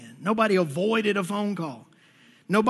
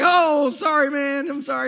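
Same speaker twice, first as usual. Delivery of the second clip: sheepish apology; 5 semitones higher than usual. Retold or imitated. Friend caught apologizing.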